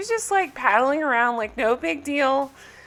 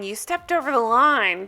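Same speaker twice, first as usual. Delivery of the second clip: ordinary talk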